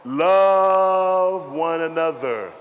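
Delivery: very slow, drawn-out loving words; tender quote of Jesus. Acted. Pastor quoting Jesus to the church, slow and tender. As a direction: stretch each word with tenderness. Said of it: "But Jesus says".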